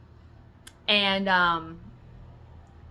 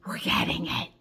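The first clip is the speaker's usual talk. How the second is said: whispered, intense; mock crazed whisper. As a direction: hiss it like a madwoman. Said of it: "I was like a crazy person".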